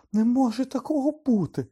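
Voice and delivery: high, loud cry of disbelief; shocked shout. A narrator's reading. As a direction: cry out in shrill protest. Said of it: "крикнула Герміона".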